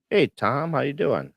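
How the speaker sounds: ordinary talk, nothing marked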